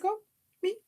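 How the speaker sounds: high, soft, bashful; a mock blushing squeak; flattered coy voice. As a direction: act shy and flattered. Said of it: "you're like".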